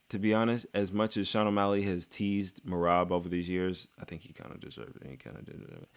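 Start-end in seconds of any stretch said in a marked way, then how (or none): 4.0-5.9 whisper. Real, not acted. Confessing aside under breath.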